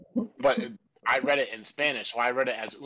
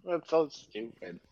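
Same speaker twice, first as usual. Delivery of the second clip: ordinary delivery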